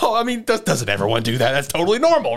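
high, mock-innocent protest; flustered excuse. Shinpei explaining away two spoons to Mio, flustered.

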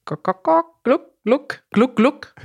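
high, clucking hen sounds; chicken clucking. Shown as a demonstration. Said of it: "wie schreibt man das denn auf Deutsch?".

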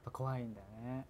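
hushed confiding whisper. Real, not acted. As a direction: breathe it like a secret. Shared fear of phone calls.

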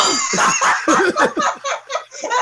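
high burst of laughter; helpless high-pitched laugh. Real, not acted. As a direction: crack up at your own punchline. Cracking up at own punchline.

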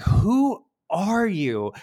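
high, slow, stunned question; surprised. Retold or imitated. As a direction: gasp it out in disbelief. Retelling shocked recognition.

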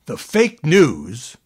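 reading his words out slowly, making a point; emphatic quote. Retold or imitated. Anchor quoting Trump's post to skeptics, slow and emphatic. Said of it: "I shall quote it in full".

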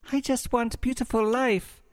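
put-on high, soft, cooing online girl; breathy girl voice. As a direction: read it like a dreamy bot profile. Bot bio read in voice.

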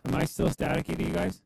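crackly robotic mic signal; staticky. Real, not acted. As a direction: voice breaking up through static.

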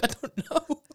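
high voice breaking into laughter; laughing high punchline; Danny Boy. Retold or imitated. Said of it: "He's like".

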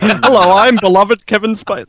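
high, bright-pitched hammy hello; mock Kevin Spacey greeting. Host imagines Kim greeting in a high hammy voice. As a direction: sing out the greeting like a proud star. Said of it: "he'll be like".

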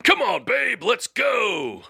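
big excited yell. Acted. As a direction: shout it out to your babe. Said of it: "I will yell".

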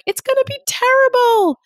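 very high, squeaky alarmed voice; pain voice. Acted. Speaker voicing her pain voice to the host, shrill high alarm. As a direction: voice the fretful inner worrier. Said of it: "She's saying things like".

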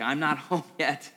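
wry laugh mid-line; displaced listener, a wry half-laugh; rueful laugh. Acted. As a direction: laugh it off a little sadly.

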